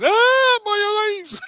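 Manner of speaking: mock pained scream. Laughing retelling of a maced man, loud high mock scream. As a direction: shriek like pepper spray hit your eyes. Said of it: "like, going".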